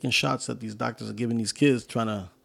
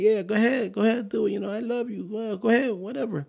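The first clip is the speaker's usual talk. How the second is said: pitched way up, careless and dismissive; mocking enabler voice; 10 semitones higher than usual. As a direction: toss it off like you don't care what happens.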